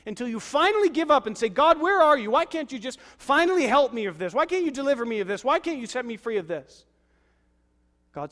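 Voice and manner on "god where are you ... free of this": higher, louder pleading cry; desperate plea. Retold or imitated. Believer crying out to God, loud and high. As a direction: beg heaven in despair.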